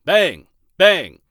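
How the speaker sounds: the wolf knocking on the door, loud knocking. Acted. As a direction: pound on the door with your voice. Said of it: "knocked on the door twice".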